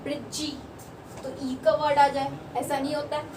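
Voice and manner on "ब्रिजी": ब्रिजी said softly, with the silent e wrongly sounded as ी; mock-wrong ब्रिजी with an added ee ending; wrong extra e. Shown as a demonstration. Teacher to students, quiet wrong example. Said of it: "अपन ब्रिजी तो नहीं बोलते हैं".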